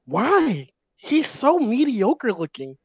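high, drawn-out disbelief; baffled and a little outraged; incredulous. Real, not acted. Incredulous rant about [name].

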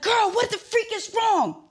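high, loud, drawn-out demand; reenacted panicked shout; worried outburst. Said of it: "Now I'm getting worried".

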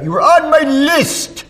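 high, loud character yell with drawn-out words. Acted. College friend yelling out from his room, high mock-outraged yell. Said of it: "he would yell it out".

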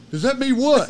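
high, loud startled question; scared friend. Retold or imitated. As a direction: imitate a man afraid of his shadow. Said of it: "a little bit scared of his shadow".

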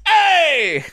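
high yelped hey; [name] hey. Retold or imitated. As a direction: blurt a high sudden hey. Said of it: "when someone says".

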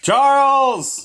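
high loud call. Real, not acted. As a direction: shout his name happily. Calling out to friend.

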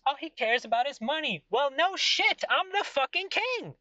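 high, proud, boastful king voice; arrogant king. Acted. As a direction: play the smug king who only cares about money.

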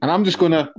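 ordinary talk, nothing marked

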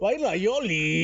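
slow northern Mexican drawl; northern Mexican accent. Retold or imitated. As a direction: draw out the words, northern Mexican style. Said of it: "Tienes que hablar como norteño".

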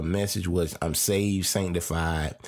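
normal talking voice

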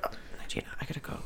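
hushed unvoiced whisper. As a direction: whisper it urgently. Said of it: "I was like".

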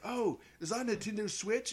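high-pitched imitation of an Australian waiter; Australian accent. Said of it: "he had, like, an Australian accent".

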